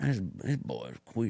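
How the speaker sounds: a quiet mock Alabama drawl, slow. Mocking redneck clerk.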